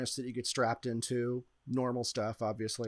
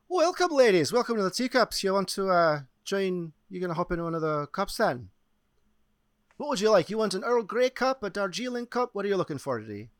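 higher, louder welcoming ride attendant voice; cheery teacup attendant; 6 semitones higher, 4 dB louder than usual. Acted. Attendant welcoming guests to the teacups, higher and lively. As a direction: greet riders with a pitched-up, projected hostess voice.

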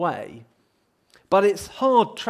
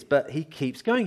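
usual speaking voice

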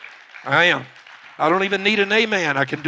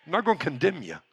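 ordinary talk, nothing marked

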